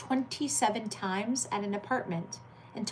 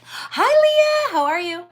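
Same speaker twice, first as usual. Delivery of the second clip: excited greeting; 13 semitones higher, 13 dB louder, slower than usual. Real, not acted. Streamer greeting a chatter by name.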